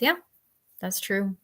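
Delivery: ordinary delivery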